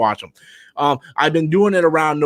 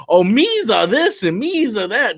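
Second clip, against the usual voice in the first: slow, higher mock Gungan accent; 6 semitones higher than usual. Mocking how Gungans supposedly talk, in Gungan accent. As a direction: ham up the Gungan accent, drawn out. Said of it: "the Gungan accent".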